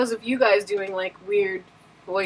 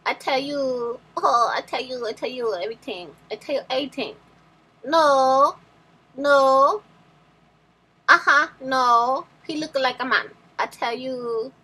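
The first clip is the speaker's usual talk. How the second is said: fake character voice; 3 semitones higher than usual. Playing a made-up character for a guessing game, in a put-on voice. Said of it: "the first two were fake people".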